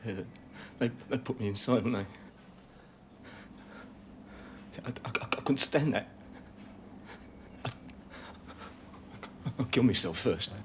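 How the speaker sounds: soft, stammering, near breaking; frightened and faltering. Acted. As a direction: let the fear crack through the words. Gunman breaking down, afraid.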